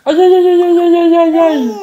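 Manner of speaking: aïe aigus et forts, moitié rire; aïe en riant. Real, not acted. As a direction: plainte exagérée pour rire. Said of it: "je sens sa gencive". (le bébé croque ses doigts, aïe forts en riant)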